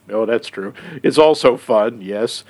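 ordinary talk

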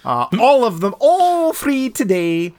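mock infomercial hype; hyped-up ad read. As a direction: sell it like a cheesy commercial. Mock ad pitch for website.

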